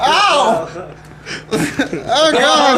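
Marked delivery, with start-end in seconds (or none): 0.0-0.7 pained yelp. Real, not acted. Bird bites hand.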